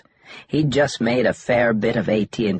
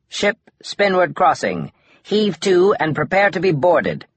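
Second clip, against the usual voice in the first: high, loud command over comms; official hail; 11 semitones higher, 5 dB louder than usual. A narrator's reading. Customs officer orders the ship to stop, loud and high. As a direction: bark the order to heave to.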